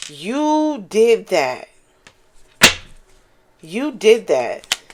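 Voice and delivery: drawn out, every word slowed; slow praise. Real, not acted. Reader hyping up the viewer, slow emphatic praise. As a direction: savor each word like a proud friend.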